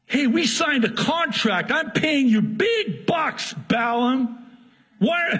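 high, exasperated employer demanding answers; infuriated Balak. Acted. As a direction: protest the broken contract, rising to a high Why. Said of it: "Balak is infuriated".

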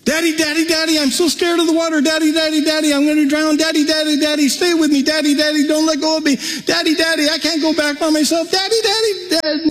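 high-pitched, breathless stream of pleas; frightened child. Retold or imitated. Scared girl clinging to daddy in the water, frantic high pleading. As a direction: imitate a panicky little girl begging for help. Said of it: "her intonation and how she took the word up, calling on her dad for help".

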